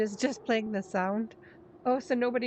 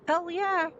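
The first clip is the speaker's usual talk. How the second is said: excited cheer; 8 semitones higher than usual. Acted. Cheering himself up.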